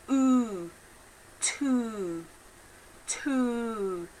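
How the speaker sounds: "Two" with a long rounded oo; rounded demo two. Shown as a demonstration. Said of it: "Nice round lips".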